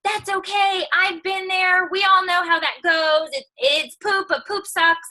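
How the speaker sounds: chirpy imitated supportive followers; high mock fan voice. Reading out followers' comforting messages in a high mock voice. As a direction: voice the fans' messages, pitched well up. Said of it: "they were all messaging me saying".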